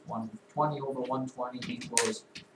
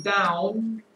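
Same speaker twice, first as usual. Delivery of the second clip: down said higher and louder; stress on down; 5 semitones higher, 7 dB louder than usual. Shown as a demonstration. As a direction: lean hard on the word down. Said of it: "emphasis on the word".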